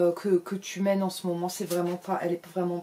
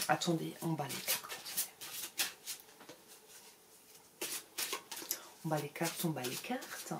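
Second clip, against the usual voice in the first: hushed mock psychic; 3 semitones lower, 12 dB quieter than usual. Parody of shuffling cards.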